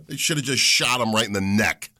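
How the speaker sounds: normal talking voice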